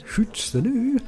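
high-pitched mock Scottish; Scottish accent. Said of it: "I tried a Scottish".

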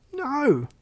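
high, emphatic protest; alarmed refusal. A narrator's reading. Oliver refuses to let cat be eaten.

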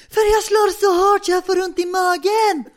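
very high, slow, loud shriek; shrieking neighbour. Retold or imitated. Neighbour in quarrel over her ceiling, shrieking.

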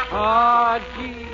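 usual speaking voice

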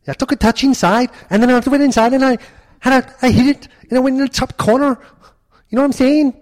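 high-pitched Birmingham lad boasting about his goal; Birmingham accent. Pretend. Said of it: "Birmingham boy".

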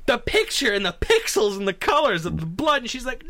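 ordinary talk

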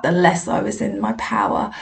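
ordinary delivery

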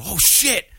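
sudden high, loud yelp of surprise; retelling a neighbor spotting Michael Myers, startled yelp; startled exclamation. Retold or imitated. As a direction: blurt it out like you just got spooked. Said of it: "I hear the lady go".

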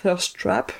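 thirst trap said the English way in an English accent; French speaker trying an English accent. Shown as a demonstration. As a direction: give it your best English accent. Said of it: "attention à mon accent anglais".

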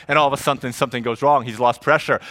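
ordinary talk

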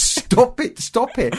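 high mock shout, laughing through it; retelling wife's sleep-shouting, mildly mocked; retold shout. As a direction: yell it like a dream outburst, half-laughing. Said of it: "she shouted at the top of her voice".

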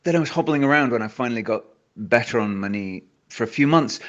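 usual speaking voice

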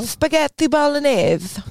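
high, slow mock-Italian with a lisp; lisped Italian accent. Shown as a demonstration. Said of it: "an Italian accent's probably a good one".

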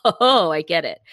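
loud mock eureka moment; imitated sudden realisation. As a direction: act out the penny dropping, loudly. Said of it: "as soon as you see the answer, you're like".

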